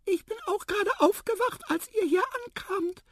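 very high tiny mouse voice; squeaky mouse. A narrator's reading. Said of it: "piepste die Maus". (piepsige Mäusestimme)

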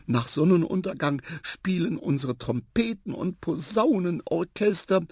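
high, enthusiastic hostess voice; gushing elephant aunt. A narrator's reading. Aunt inviting guest to family party, high gushing enthusiasm. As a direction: sell the party in a high, excited voice.

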